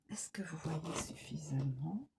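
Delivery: hushed whisper to the viewers; whispered question. Real, not acted. Reader checks the viewers can see, whispering. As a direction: ask it under the breath. Asks viewers while adjusting camera.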